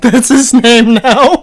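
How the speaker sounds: slow high laughing words. Real, not acted. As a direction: wheeze it out through laughter. Laughing repeat of joke.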